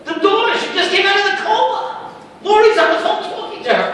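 very high, loud joyful outburst; woman shouting that the daughter woke from the coma, high and loud; excited shout. Retold or imitated. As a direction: burst out with the miracle news.

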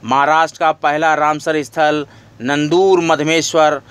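ordinary talk, nothing marked

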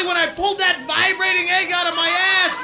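ordinary talk

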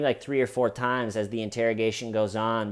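ordinary talk

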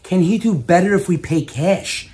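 ordinary talk

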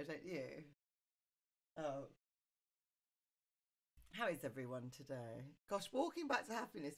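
ordinary talk, nothing marked

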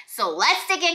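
usual speaking voice

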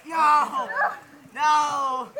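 loud panicked no; panicked shout. Real, not acted. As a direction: cry out in real alarm. Boat sinking, real panic.

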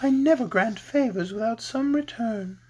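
high, airy lady's voice; sweet lovely lady. A narrator's reading. Lady Oz bargaining with Scarecrow, sweet. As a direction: state terms lightly and smoothly.

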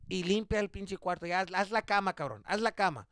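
a hint of a mom nagging her son to clean; scolding mom.

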